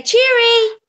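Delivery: put-on high "Cheery" to coax a toddler. Shown as a demonstration. Mum coaxing toddler to say Cheery, high and coaxing. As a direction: pitch it up for the child.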